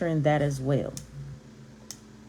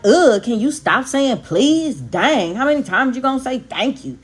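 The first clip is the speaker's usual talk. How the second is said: loud, higher mock-annoyed outburst; fed-up quote, pitched up; exasperated mimicry; 5 semitones higher, 4 dB louder than usual. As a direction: imitate someone fed up with politeness. Voices annoyed people from group home.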